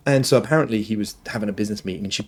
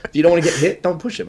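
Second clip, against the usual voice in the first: lightly voiced dad, pitched up; mock scolding of a child, higher voice; parent telling off; 7 semitones higher, 3 dB louder, faster than usual. Dad retelling what he tells his daughter, mild telling-off. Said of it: "I say that to my daughter all the time".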